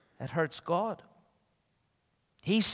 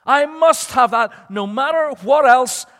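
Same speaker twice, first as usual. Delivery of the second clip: high, loud, drawn-out wanting; desperate craving; 7 semitones higher, 10 dB louder, much slower than usual. Acted. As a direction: insist on it slowly, pitched right up. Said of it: "Do you ever feel like that".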